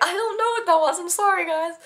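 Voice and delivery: high-pitched mock-helpless apology; flustered apology. Actress apologizing to crew for accidental accent, flustered.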